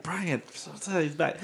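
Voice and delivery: slow, soft, hushed alert; hushed warning. Retold or imitated. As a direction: drag it out quietly as a warning. Friends warning quietly.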